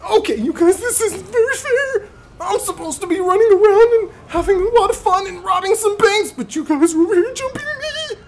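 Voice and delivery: high, whiny teacher voice cracking with laughter; teacher's voice. Acted. Teacher whining to the players who jump him, high and laughing. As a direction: wail the complaint as the teacher, laughing through it. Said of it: "Anyway, the teacher".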